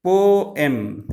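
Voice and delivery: "Poem" in two beats, po-em; teacher splitting "Poem" into two syllables. Shown as a demonstration. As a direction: draw out both halves of "Poem". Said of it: "two syllabic words".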